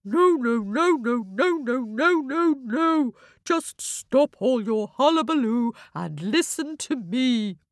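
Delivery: high, firm owl voice hushing the crowd; stern owl. A narrator's reading. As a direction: call the squabbling birds to order. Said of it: "said Owl".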